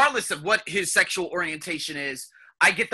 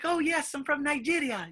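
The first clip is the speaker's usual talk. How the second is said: higher, softer attempt at a Nigerian accent; poor Nigerian accent; 8 semitones higher, 7 dB quieter than usual. Client introducing herself on a call, badly imitated Nigerian accent. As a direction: have a clumsy go at a Nigerian accent. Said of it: "I can't do the Nigerian accent".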